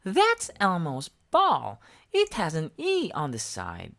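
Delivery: small eager monster voice; Elmo voice. A narrator's reading. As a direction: squeak it up like Elmo. Elmo arguing back.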